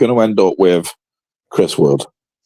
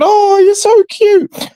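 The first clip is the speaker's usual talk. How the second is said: much higher, slow, cooing mock swoon; mock swooning girls; 4 dB louder, much slower than usual. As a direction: coo it like smitten students, slowly and way up high. Imitating students fawning over Gnonto.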